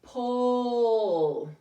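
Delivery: Pole with a long, drawn-out O. Shown as a demonstration. Teacher drawing out the long O for the class. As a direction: stretch the O in Pole. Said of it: "he makes that O long".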